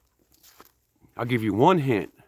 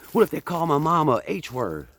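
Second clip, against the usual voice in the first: much higher, put-on anxious question; mock worried bouncer; 7 semitones higher than usual. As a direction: play a nervous rookie asking the boss. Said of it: "what Patrick Swayze said in Roadhouse".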